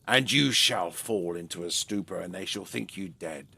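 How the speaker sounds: low, hushed voice of a scheming trader. Acted. The trader tempts her with the poison, low and hushed. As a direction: murmur the plot low and soft, savouring it. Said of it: "he looks at her and he says".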